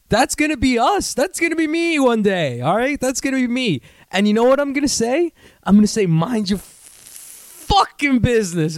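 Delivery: high-pitched, worked-up comeback; defiant rant. Acted. Ranting imagined defiant comeback.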